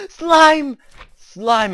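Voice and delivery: high excited gasp of discovery; delighted gasp. Real, not acted. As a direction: burst out in joy at finding them. Gasps at finding pets.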